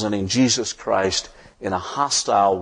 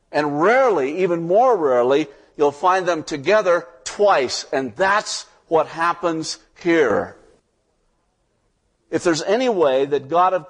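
rising preaching fervor; 6 semitones higher than usual. Acted. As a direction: preach it up, climbing toward the big promise. Preacher builds to the promise.

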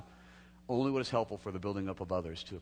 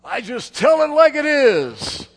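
much higher, louder, slow mock boast; proud bluntness; 18 dB louder, much slower than usual. Preacher mocks proud blunt people, slow, loud and high. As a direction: drag it out, loud and high, full of pride. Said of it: "have you ever had somebody say to you".

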